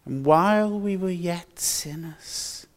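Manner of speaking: usual speaking voice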